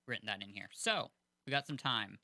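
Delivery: normal talking voice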